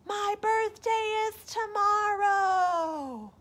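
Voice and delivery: high, slow echoing voice. Acted. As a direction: let it drift back like an echo. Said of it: "this is what he heard".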